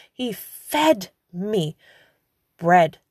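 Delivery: usual speaking voice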